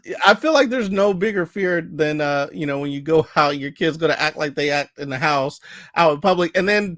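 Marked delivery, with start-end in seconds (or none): none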